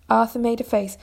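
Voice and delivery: usual speaking voice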